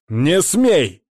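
high, loud barked command; barking order. A narrator's reading. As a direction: bark it at him. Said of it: "рявкнул безопасник".

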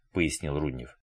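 ordinary delivery

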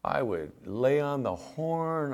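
usual speaking voice

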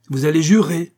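angry threat. A narrator's reading. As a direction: force the oath on him with rising anger. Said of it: "eut un frémissement de colère".

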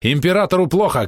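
high, loud alarmed cry; shouting. A narrator's reading. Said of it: "крикнул Ральф".

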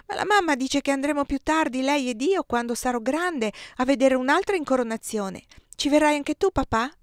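put-on a little boy sharing a promise; child inviting father; eager child. A narrator's reading.

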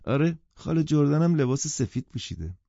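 put-on high child's voice; little child. A narrator's reading. Child answering her mother, in a little-girl voice. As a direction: answer in a small girl's voice. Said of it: "بچه خیلی آرام گفت".

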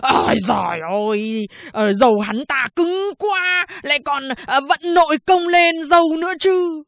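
high, loud, wailing barber voice; whining barber. Acted. Barber wails his excuse to the policewoman, high and loud. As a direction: wail the excuse to the officer.

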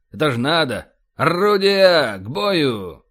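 building to a loud, drawn-out command; battle-stations call. Acted. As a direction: call the guns to action, slowly and loudly. Bombardier calls crew to battle stations. (протяжная боевая команда)

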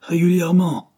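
high, loud, indignant echo; thundering retort. A narrator's reading. Landlord throwing the tenant's word back, thundering. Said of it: "tonna le propriétaire".

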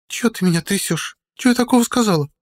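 put-on high, sulky drunken voice; drunk and offended. A narrator's reading. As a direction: pout like a tipsy woman. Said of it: "Пьяно обиделась женщина".

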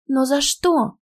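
high-pitched, bewildered protest; aghast question; dismayed protest. A narrator's reading. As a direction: plead in disbelief. Student protests her sudden expulsion.

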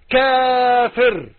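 "كافر" stretched very long on a held note; drawn-out key word. Acted. Preacher reveals the word on the Dajjal's forehead, long drawn-out delivery. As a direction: hold the first vowel of "كافر", then let it fall.

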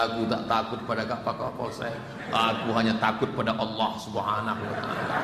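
low, slurry hint of a drunk boy; a stoned teen's defiant reply; drunk youth. Drunk youth answers police chief.

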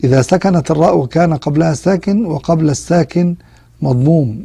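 ordinary delivery

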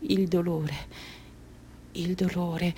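soft, subdued voice; hushed suffering. Acted. Mutilated girl returning to the pain, hushed suffering. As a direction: drop back quietly into the pain.

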